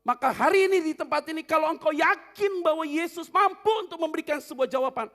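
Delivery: loud, high preacher's call; fiery preaching. Acted. Pastor urging the congregation, loud and fervent. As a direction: preach it to the crowd.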